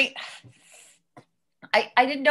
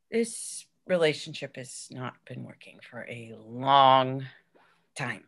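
somber breakup; 12 semitones lower, 5 dB quieter than usual. Acted. Breakup, final words.

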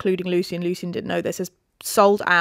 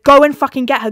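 loud, raised, insistent urging; mock urgent command; 5 semitones higher, 8 dB louder, faster than usual. Everyone urges Reese to fetch Feyre, loud mock urgency. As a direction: urge it out loud and high.

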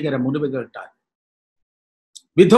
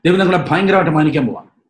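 usual speaking voice

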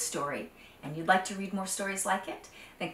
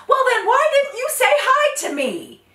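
much higher, louder whining accusation; indignant Python; 12 dB louder than usual. Acted. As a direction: demand an answer, offended.

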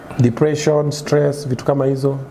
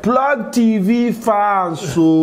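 high, very slow intro call; high drawn-out announcer call; 8 semitones higher, much slower than usual. Shown as a demonstration. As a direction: stretch it out, pitched high. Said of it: "show intro catchphrase".

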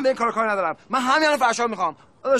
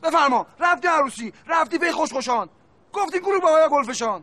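shouted, higher accusation; angry scolding; 5 semitones higher than usual. Acted. Angry customer shouts at the brothers about the wedding. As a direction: tear into them for going to a wedding.

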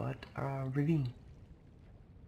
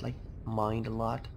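usual speaking voice

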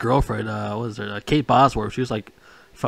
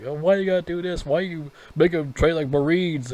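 whiny coach; 6 semitones higher, 4 dB quieter than usual. Retold or imitated. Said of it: "like, whiny".

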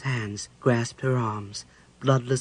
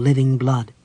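plain reading voice

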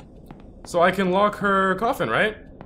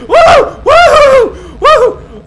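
much higher, loud laughing whoops; 13 dB louder, much slower than usual. Real, not acted. Streamer shaking off the scare, high laughing whoops. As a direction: whoop the fear away. Shaken after jumpscare.

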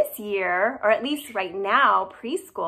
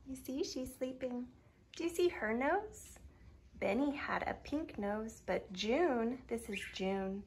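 soft, hushed voice so the cat sleeps on; whisper-quiet so you don't wake her; 9 dB quieter than usual. Real, not acted. Cat asleep, talking hushed.

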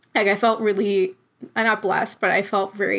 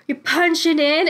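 higher, louder call to the crowd; singer's call; 7 semitones higher, 3 dB louder than usual. Retold or imitated. Frontman calling to the crowd, higher and louder. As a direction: belt it out like the frontman leading a chant. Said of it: "he'll literally be like".

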